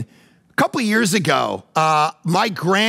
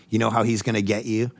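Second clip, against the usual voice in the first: ordinary delivery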